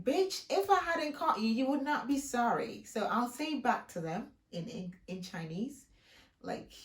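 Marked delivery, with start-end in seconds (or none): 0.0-2.8 sassy retort. Retold or imitated. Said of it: "I'm like".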